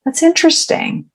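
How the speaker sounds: intrigued, thinking aloud; curious. Acted. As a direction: puzzle it over with lifted curiosity. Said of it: "I get curious".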